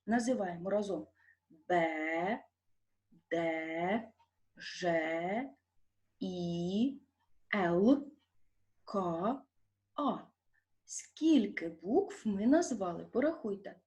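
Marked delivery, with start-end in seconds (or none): 1.5-10.2 letter names one by one, with pauses; naming letters. Shown as a demonstration. Teacher leading pupils through the letters, spelling aloud. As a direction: recite each alphabet name separately, measured and low-key. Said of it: "Назвемо букви ... так, як вони є в алфавіті".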